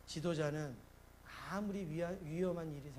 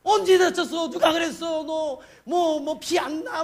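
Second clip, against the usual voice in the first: high, loud panicked father; hammed-up hysterical dad; frantic fussing; 11 semitones higher, 18 dB louder, slower than usual. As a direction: shriek worried questions in a frenzy. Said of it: "오두방정 떨면서".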